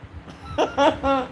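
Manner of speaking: amused chuckle at oneself; short burst of giggling; laugh. Real, not acted. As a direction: chuckle after a silly bit. Laughing at own fake accent.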